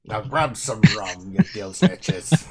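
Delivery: slow raspy pirate growl. Pretend. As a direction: growl it at the crew. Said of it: "that hurt my throat tremendously".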